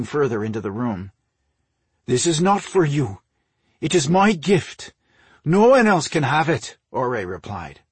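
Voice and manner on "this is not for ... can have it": higher, louder, forceful refusal; possessive refusal. A narrator's reading. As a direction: claim it fiercely, voice raised. Possessed king refuses to give up box.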